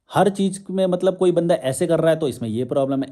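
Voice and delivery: ordinary delivery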